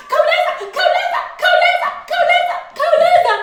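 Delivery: fast. Shown as a demonstration. Teacher chanting khawuleza for fast, quick and high. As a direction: rattle off khawuleza at speed. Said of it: "Let's be khawuleza".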